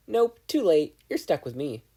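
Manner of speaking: high, loud and smug; smug gloating. Acted. The conscience teasing Katsuki, high and smug. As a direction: crow it over him, gloating.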